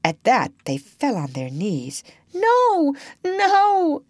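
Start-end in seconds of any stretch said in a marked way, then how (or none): 2.3-4.0 high, pleading wail; piteous cry. A narrator's reading. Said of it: "they cried so piteously".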